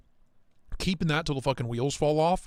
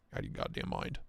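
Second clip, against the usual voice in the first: soft unvoiced whisper under the breath; whispered aside; 12 dB quieter, faster than usual. Real, not acted. To viewers about a listing price, a whispered aside. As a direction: mutter it to yourself in disbelief.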